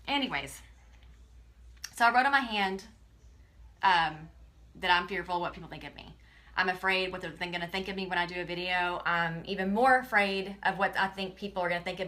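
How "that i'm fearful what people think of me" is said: usual speaking voice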